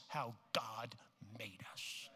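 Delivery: hushed whisper. Real, not acted. Preacher hushing his congregation, whispered. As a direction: drop to a secret whisper. Whispered close after climax.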